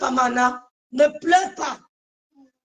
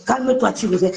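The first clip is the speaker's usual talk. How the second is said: ordinary talk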